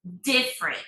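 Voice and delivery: "Different" stressed on the first syllable, DIF-rent; clipped "Different" stressed on DIF. Shown as a demonstration. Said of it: "it's in the first syllable".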